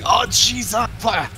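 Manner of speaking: high, loud mock exclamation; mock outburst. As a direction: imitate a startled, fumbling spellcaster. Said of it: "going like".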